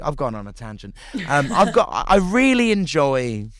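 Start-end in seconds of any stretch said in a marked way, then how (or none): none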